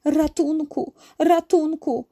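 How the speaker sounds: high, urgent dolphin voice; crying for help. A narrator's reading. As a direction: call for help, pitched high. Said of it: "zawołał delfin".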